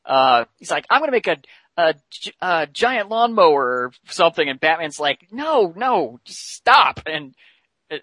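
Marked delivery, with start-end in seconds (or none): 0.9-3.6 high-pitched excited pitch of an idea; eager hero. Retold or imitated. Voicing eager hero in retold story. 5.3-7.0 high, exasperated cut-off; pleading for him to stop, pitched up; exasperated objection. Retold or imitated. Exasperated Batman cuts off hero's idea.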